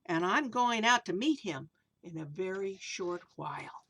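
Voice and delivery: storybook verse reading; reading to children. A narrator's reading. Reads rhyming picture book aloud.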